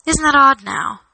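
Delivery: ordinary delivery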